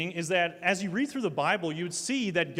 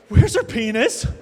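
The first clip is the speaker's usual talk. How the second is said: put-on concerned little boy; 3 semitones higher, 9 dB louder than usual. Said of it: "so much concern".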